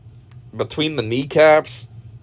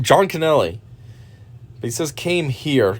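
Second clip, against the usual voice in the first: ordinary talk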